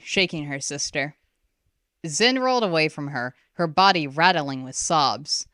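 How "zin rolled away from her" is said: ordinary delivery, nothing marked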